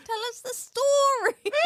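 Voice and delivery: shouted, high demand; playful loud high insistence; mock demand. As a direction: yell it like an impatient heckler.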